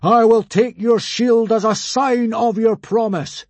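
slow, high, loud proclamation; grand booming pronouncement; crying out. A narrator's reading. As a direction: declare it like a mighty god. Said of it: "cried Hiccup".

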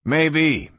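plain reading voice

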